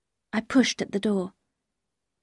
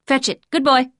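louder, higher call to a dog; 4 semitones higher, 6 dB louder than usual. Acted. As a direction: urge the dog on playfully. Throwing a stone for dog.

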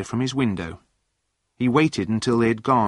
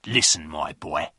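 low, menacing address; controlled anger, pitch dropped; angry threat; 4 semitones lower than usual. A narrator's reading. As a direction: lean on him with a lowered voice. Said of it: "went up to John angrily".

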